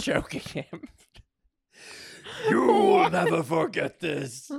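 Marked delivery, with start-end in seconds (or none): 2.5-4.5 loud, raised, slowed-down cry; hammed-up loud scream. A narrator's reading. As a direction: scream it out slowly. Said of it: "she screamed".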